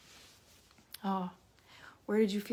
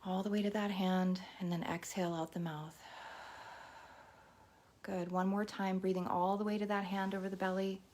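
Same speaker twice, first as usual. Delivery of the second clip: guided meditation. Acted. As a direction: guide the listener gently into the breath.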